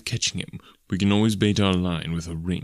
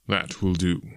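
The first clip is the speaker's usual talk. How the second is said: ordinary delivery, nothing marked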